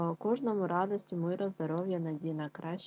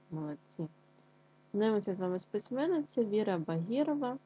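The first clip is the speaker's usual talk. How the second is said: ordinary talk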